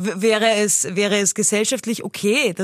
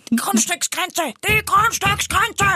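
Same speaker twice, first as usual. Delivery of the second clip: much higher, slow, screeching mock mum; screaming Lois; 10 semitones higher, slower than usual. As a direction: screech the boundary like the angry sitcom mother. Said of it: "muss ich sie nachmachen". (kreischende Lois-Parodie, viel höher und langsam)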